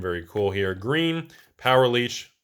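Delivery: ordinary talk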